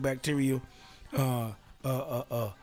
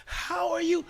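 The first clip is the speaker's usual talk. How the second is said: high, bright mock-cheerful hello; cheery greeting. Host greeting the crowd, high mock-cheerful. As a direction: sing out a fake-friendly greeting. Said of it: "When I go in front of y'all and be saying".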